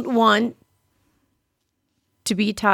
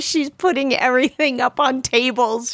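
ordinary delivery

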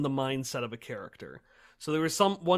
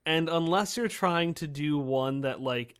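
usual speaking voice

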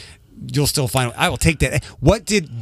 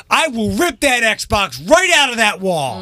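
higher, slow mock parental threat; 7 semitones higher, slower than usual. Fed-up parent to kid, slow mock threat. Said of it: "the one that you threaten all the time".

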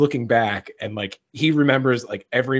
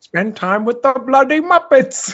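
high, loud laughing Cockney Caine voice; mock Michael Caine, Cockney and amused; Michael Caine impression; 9 semitones higher, 5 dB louder than usual. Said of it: "your Michael Caine impression".

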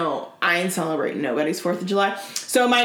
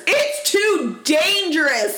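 high, slow, mocking repeat; mocking exaggeration; 8 semitones higher, much slower than usual. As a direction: drag it out in mock alarm. Mocking repeat of cousins' line.

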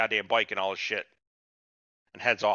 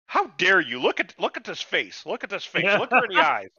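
higher-pitched imitation of an outraged woman; mock indignation; 7 semitones higher, 3 dB louder than usual. Woman scolding her partner over his dinner remark, mock indignant. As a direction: play up her offended outrage. Retold indignant outburst in an argument.

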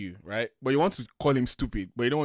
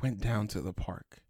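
hushed, slow bedtime narrator; soft storyteller voice; 5 semitones lower, 8 dB quieter, slower than usual. Retold or imitated. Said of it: "He should be like this".

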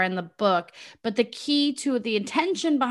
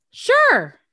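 eager sure; 12 semitones higher, 7 dB louder than usual. Retold or imitated. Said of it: "what had you say".

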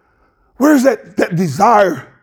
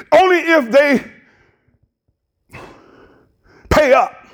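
raised, higher preaching cadence; fervent preaching; 6 semitones higher than usual. Acted. Pastor preaches to his church about loving one another, fervent. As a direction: preach it out to the back pew.

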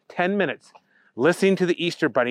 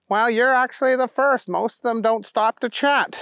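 high, chatty dim fellow; Booger character voice; 9 semitones higher than usual. A narrator's reading. Booger answering the bunny's question, high and chatty. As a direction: pitch it up as Booger.

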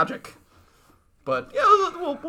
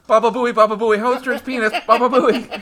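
loud, higher mock prank call; prank caller shout; 7 semitones higher, 8 dB louder than usual. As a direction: yell it like a radio prank caller. Prank caller bit on mic.

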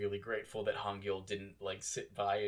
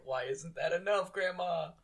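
higher, pleading appeal; higher hurt plea to grandma; pleading question; 8 semitones higher than usual. Retold or imitated. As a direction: ask it up high, hurt. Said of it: "it's like".

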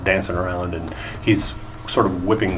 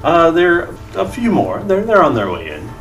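flamboyant gnome bard; 9 semitones higher, 5 dB louder than usual. Acted.

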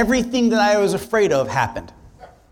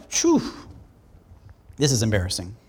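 embarrassed phew; 8 semitones lower, 5 dB quieter than usual. Retold or imitated. Awkward prayer with no answer.

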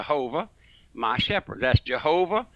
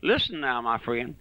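ordinary delivery

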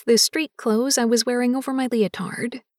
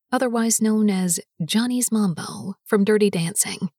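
ordinary delivery

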